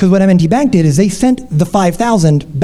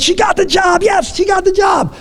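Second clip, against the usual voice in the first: much higher mock-triumphant shout; excited; 11 semitones higher, slower than usual. Real, not acted. Host telling listeners she got the job, high excited mock cheer. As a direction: cheer it like she won the lottery.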